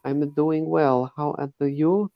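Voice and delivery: put-on girl's voice. Host attempting Laura's accent, high girlish voice. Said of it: "hard for me to sound like a girl".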